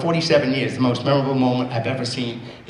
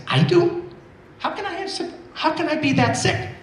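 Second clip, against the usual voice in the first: much higher, stunned questions; a man hearing his diagnosis, shocked and high; shocked disbelief; 14 semitones higher than usual. Retold or imitated. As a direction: reel at the bad news, voice jumping up. Hearing of severe heart disease.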